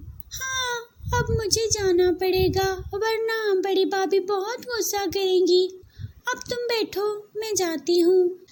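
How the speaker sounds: put-on a young girl rushing off to her chores. Aliya the girl speaks. (ऊँची आवाज़ में छोटी लड़की)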